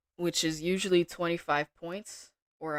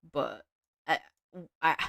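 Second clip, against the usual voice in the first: halting, stumbling restart; stuttering. Real, not acted. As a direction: trip over the words. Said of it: "I'm stuttering".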